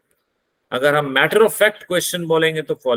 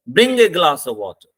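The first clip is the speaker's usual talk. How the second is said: starts strong, voice falls on water; falling tone command; 3 dB louder, much slower than usual. Shown as a demonstration. Teacher demonstrating a command to students, slow with a falling tone. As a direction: give the order, drop the pitch on water. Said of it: "water तक आते हुए हमारी आवाज down हो चुकी है".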